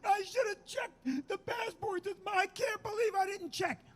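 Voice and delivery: high, drawn-out wailing imitation; mock hysterical crying. Husband imitating his wife crying over expired passports, mock hysterical crying. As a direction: mimic a sobbing wife blaming herself. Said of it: "Vicky is crying hysterically".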